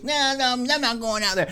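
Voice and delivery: a hint of a refusing little girl; whiny kid sister. Kid sister refusing to fetch the mail, lightly whiny.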